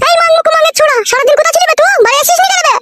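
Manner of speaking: high-pitched wife's telling-off; angry scolding. Acted. Wife confronts husband for being out all day, angry scolding. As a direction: demand answers in a high, angry voice.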